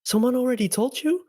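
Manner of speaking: high, halting flustered character voice; super flustered. Retold or imitated. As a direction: act thrown off, stumbling for words. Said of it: "like, super flustered".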